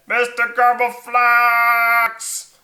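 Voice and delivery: loud, high, drawn-out holler; long stretched shout of the name; calling out. Acted. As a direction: yell for him through the door. Liam calls at dragon's door.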